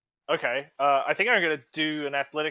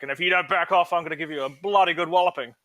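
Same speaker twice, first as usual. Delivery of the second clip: mock threat; 4 semitones higher, 6 dB louder than usual. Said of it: "I'm gonna be like".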